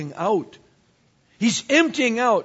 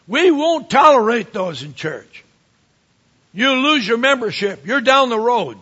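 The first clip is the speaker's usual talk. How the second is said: full-force preaching; 3 semitones higher, 6 dB louder than usual. Acted. Pastor warns the congregation about sins, full-force preaching. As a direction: proclaim it like a revival preacher.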